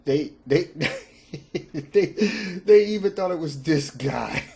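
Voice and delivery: stammering through bursts of laughter; barely getting words out, cracking up; laughing fit. Real, not acted. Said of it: "I can't stop laughing".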